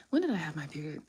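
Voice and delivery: unvoiced whisper with a sigh; whisper to herself. Real, not acted. Reader whispers to herself, trying to recall her period. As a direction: breathe it out as a private aside.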